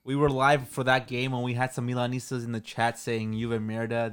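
ordinary talk, nothing marked

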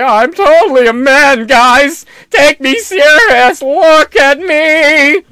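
loud, high, drawn-out parody; weird put-on voice. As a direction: wail it insistently in a strained high voice. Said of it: "where they talk like this".

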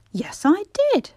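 high, loud angry retort. A narrator's reading. Peter retorts to his scornful sister, angrily. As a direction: answer back angrily, pitched right up. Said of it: "said Peter angrily".

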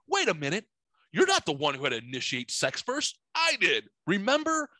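high-pitched imitated objection, slowing at the end; indignant protest. Boyfriend correcting his girlfriend before the neighbors, mock-indignant protest. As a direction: push back as the wronged boyfriend, pitch jumping up.

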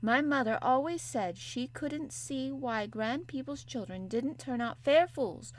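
a touch of a broad Yorkshire maid lecturing; Yorkshire maid, unhurried; lightly voiced Yorkshire maid. Martha's Yorkshire voice.

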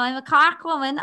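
a thicker Cork accent, hammed up a bit; a stronger mock Cork accent, still light; gnarlier Cork accent. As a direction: push the Cork accent harder. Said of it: "I had to get way more gnarly now".

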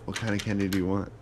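ordinary talk, nothing marked

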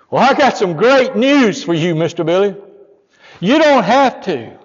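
high and loud, slowing on the last words; loud, overjoyed announcement; joyful news. Retold or imitated. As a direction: burst out with the good news. Pastor brings the good news.

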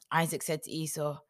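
usual speaking voice

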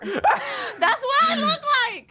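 high squeal through laughter; giggling at her own joke about the shower; laughing. Real, not acted. Laughing at herself.

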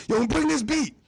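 high, imitated phone yell; retold urgent demand. Caller orders him to the studio with a beat, high urgent demand.

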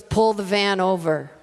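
put-on slow, low, firm command from the old lady; quoted order. Old lady calmly telling the driver to pull over, slow firm command. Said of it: "And actually, it was more like".

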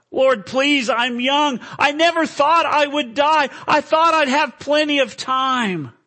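high, loud, anguished plea; desperate pleading. Acted. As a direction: plead for your life. Acted sinner pleads with the Lord.

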